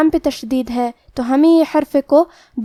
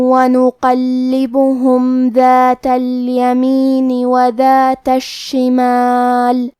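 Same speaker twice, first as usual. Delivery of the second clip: Quran recitation; slower than usual. Acted. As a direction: recite the whole verse in measured chant. Full recitation of the verse. (پوری آیت کی تلاوت)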